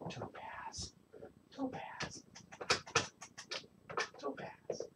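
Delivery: whispering a cat's name over and over; whispered calling. Real, not acted. Owner coaxing hiding cat Topaz, whispering her name.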